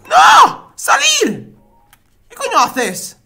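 shrill, loud cries of panic; frantic shouting. Real, not acted. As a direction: yell at the screen desperately. Urging character to flee.

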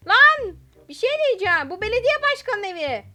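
loud, high, amazed outburst; high-pitched, thrilled announcing; excited shouting. Real, not acted. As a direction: yell the big discovery to viewers. Discovers it is the mayor's house.

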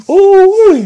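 a loud, high-pitched stunned ooh; shocked high squeal. Real, not acted. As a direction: yelp it out high. Reacting to hearing the story.

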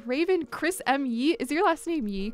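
normal talking voice

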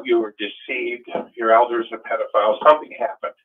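ordinary talk

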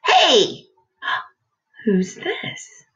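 loud hey then gasping whisper; surprised gasp. Real, not acted. Surprise at new page.

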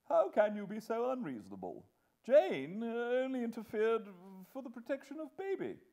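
high-pitched exasperated protest; remonstrating. Acted. Husband defending Jane to his wife, remonstrating. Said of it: "remonstrated Mister Pocket".

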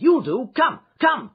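high, loud bursts; urgent cry. A narrator's reading. Bridegroom summoning a witness to the altar, crying out. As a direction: shout urgently to summon someone. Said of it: "he cried".

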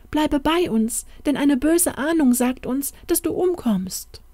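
high, urgent pleading, ending in a whisper; pleading women. Acted. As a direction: beg him to stay, voice rising. Three women plead with the prince. (flehende Frauen, hoch und eindringlich)

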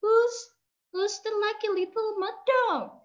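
put-on high, bright boy's call; high child calling to the dog. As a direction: call out like a cheerful boy. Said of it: "Like this boy called".

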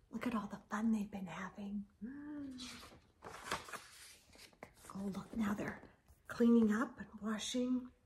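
hushed picture-book aside; whispered aside. Real, not acted. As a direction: lean in and whisper about the picture.

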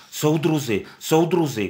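pleading with the cart horses; shouting. Acted. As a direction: call out to the horses. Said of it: "křičela".

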